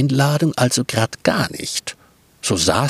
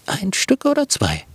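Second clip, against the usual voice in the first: put-on higher, bright little girl asking; Brotkäppchen asking politely, higher voice; friendly girl; 8 semitones higher than usual. Girl voice answering wolf.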